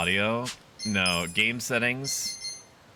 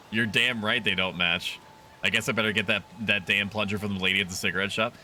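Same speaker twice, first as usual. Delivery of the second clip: ordinary delivery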